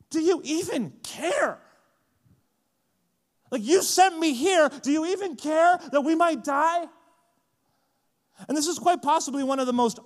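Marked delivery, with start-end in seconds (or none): 0.1-6.9 louder, higher, wounded questioning; hurt accusation. Retold or imitated. Disciples confronting Jesus in the storm, hurt accusation. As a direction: demand to know if he cares.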